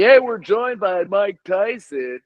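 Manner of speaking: mock a sugary talk show welcome; friendly TV host. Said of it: "the guy seems real nice at first".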